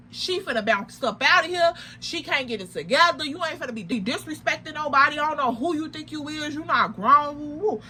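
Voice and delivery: usual speaking voice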